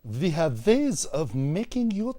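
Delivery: slow, deliberate mock German accent. Improviser leading accent warm-up, German accent. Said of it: "I'm gonna do German".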